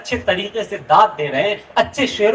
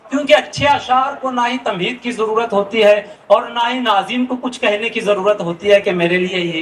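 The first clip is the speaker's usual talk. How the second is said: ordinary talk, nothing marked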